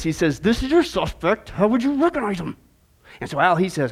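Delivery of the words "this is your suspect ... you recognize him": very testy. Acted. Policeman quizzing his last candidate, very testy. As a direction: put the question with strained patience. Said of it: "And in a very testy voice".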